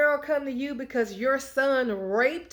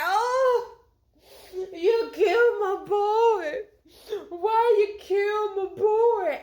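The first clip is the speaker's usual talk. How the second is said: hammed-up wailing cry, higher and slow; mock wailing; 8 semitones higher, 3 dB louder, slower than usual. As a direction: overplay a father's sobbing grief. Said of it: "David weeping and wailing and crying".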